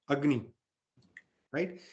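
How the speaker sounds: usual speaking voice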